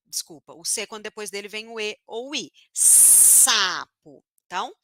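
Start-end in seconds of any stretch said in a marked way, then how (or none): 2.7-4.2 SAPO with a long hissed S at the start; prolonged S. Shown as a demonstration. Teacher repeating the example of S, long hiss. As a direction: hold the S, then drop into -APO. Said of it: "S, é o mesmo som".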